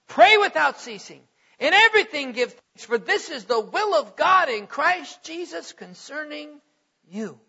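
fervent preaching. Acted. Preacher urging the church to pray, ringing out. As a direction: proclaim it to the back pews.

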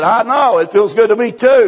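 preacher answering the weeping convert, loud and glad; excited agreement. Retold or imitated. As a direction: share his joy out loud. Preacher sharing the convert's joy.

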